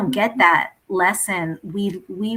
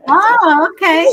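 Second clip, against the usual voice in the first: laughing; 10 semitones higher, 12 dB louder, slower than usual. Real, not acted. Laughs at picture reveal.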